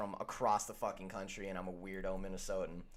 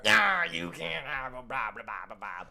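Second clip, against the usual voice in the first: hammed-up gruff local scoff, slow at first; mock Philly fan; 7 dB louder, slower than usual. Mocking Philly fans dismissing tourist spots, a hammed-up local scoff. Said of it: "the Go-Birds are".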